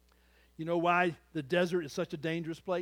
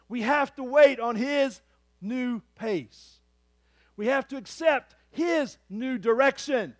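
full-force preaching; 8 semitones higher, 11 dB louder than usual. Acted. Pastor proclaiming God's promise to the church, at full force.

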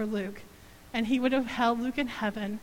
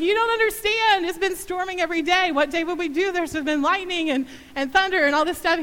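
protesting mother; 6 semitones higher, 5 dB louder than usual. Retold or imitated.

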